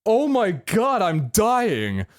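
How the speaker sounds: high, alarmed mock horror; mock panic. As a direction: play up the shock of seeing yourself so pale. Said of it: "I was like".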